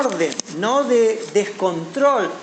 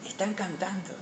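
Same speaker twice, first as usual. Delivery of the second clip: much softer, almost under the breath; hushed repeat; 2 semitones lower, 11 dB quieter than usual. Retold or imitated. Preacher repeats Moses' answer to the congregation, hushed. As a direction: drop right down in volume to let it sink in.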